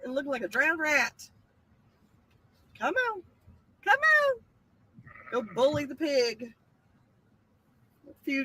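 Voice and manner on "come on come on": high coaxing call; calling animals. Real, not acted. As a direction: coax the animals over.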